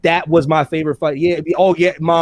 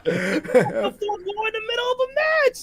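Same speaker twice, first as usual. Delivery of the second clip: high squeal through laughter; helpless laughter; 4 dB quieter, slower than usual. Real, not acted. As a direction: barely get it out, stretched by real laughing. Cracking up at own story.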